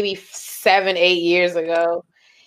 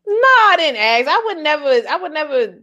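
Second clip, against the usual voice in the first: high-pitched protest; indignant denial; 7 semitones higher than usual. Real, not acted. Denying she asked the question, high indignant protest.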